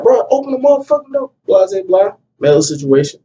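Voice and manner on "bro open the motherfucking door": high, demanding retold order. Man at the trap house door, demanding high. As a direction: bang on the door in words. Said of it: "I'm like".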